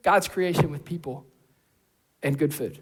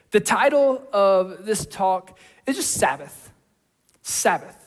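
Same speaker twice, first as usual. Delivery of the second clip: normal talking voice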